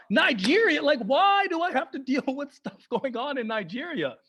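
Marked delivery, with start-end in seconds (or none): none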